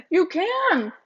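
gasp, then a high, loud realization; sudden delighted discovery; surprised gasp. Real, not acted. Realizes you can lick cake.

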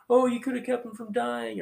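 a grieving sister's accusing cry, played up; reproachful Mary. Pastor voicing Mary blaming Jesus, in a high whine. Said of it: "Mary comes and just like".

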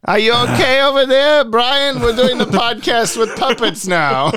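high, loud puppet voice; squeaky ventriloquist dummy. Pretend. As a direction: play a dummy checking on Brian. Talking to co-host as puppet.

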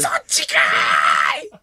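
slow stretched yell; mock tsukkomi shout. Replaying the punchline to cohost, stretched yell. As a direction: drag out the retort loudly. Repeating Oda's retort.

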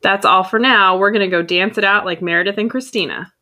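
loud, projected scripted sign-off; podcast sign-off. Acted. Host signing off to listeners, loud announcer read. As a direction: close the show like an announcer.

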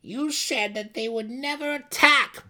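angry growl. Acted. Brisa snarling at her mother, loud and accusing. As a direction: throw the words back in her face. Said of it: "Brisa growled at her mother".

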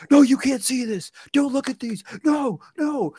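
high, loud frantic pleading. Retold or imitated. Anthony stopping Brianna seeing the paintings, high frantic pleading.

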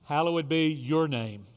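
leading the Lord's Prayer with long pauses; short phrases with pauses. Real, not acted. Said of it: "I will say a phrase".

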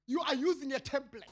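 ordinary delivery

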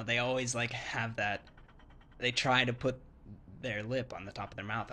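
ordinary talk, nothing marked

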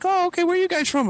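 put-on high old-lady voice. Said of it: "She's like".

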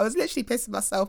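fast, laughing through it. Real, not acted. Host still laughing while telling the story. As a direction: rush it out while still giggling.

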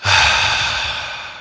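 long audible sighing exhale; deep breath. Shown as a demonstration. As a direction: breathe out slowly and fully. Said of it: "take a deep breath".

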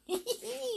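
high, soft giggle; little tittering laugh. A narrator's reading. Visitor giggles in front of the king, giggle. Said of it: "Little Miss Sunshine giggled".

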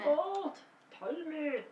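Arnold Schwarzenegger. Acted. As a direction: squeeze out a high Austrian-accented cry. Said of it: "I just can't do his accent".

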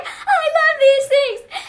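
put-on very high, stretched-out toddler squeal; imitating an overjoyed child, high and slow; excited two-year-old. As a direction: draw it out loudly like a delighted little kid. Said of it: "She was like".

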